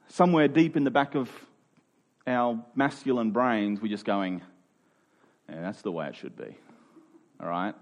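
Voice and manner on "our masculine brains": normal talking voice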